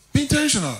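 high, insistent exhortation; emphatic charge. Real, not acted. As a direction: urge it on the congregation, voice lifted high. Repeats the charge higher.

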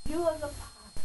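ordinary delivery

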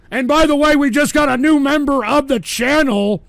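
high, loud celebratory shout-out; hyped announcement. Real, not acted. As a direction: hype the new member like a party host. Hyped new member announcement.

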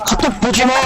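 shouted high and sharp; high yell. Real, not acted. Yelling at friend about phone.

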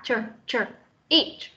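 isolated "ch", then "each" with it released; teacher drilling the final ch of "each"; sounded final consonant. Shown as a demonstration. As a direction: sound out "ch" alone and land it in "each". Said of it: "Âm cuối chúng ta phải phát âm ra".

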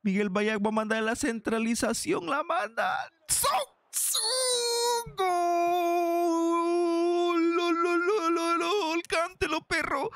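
football commentator howling a goal, syllables repeated in celebration; ecstatic goal call. Real, not acted. Gamer celebrating Son's goal, ecstatic screamed goal call. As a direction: build up to a very high, long-held goal scream. Calling his own goal live.

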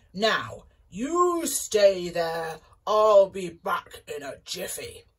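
loud, slow giant; giant booming voice. Acted. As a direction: boom it like a giant. Giant speaking.

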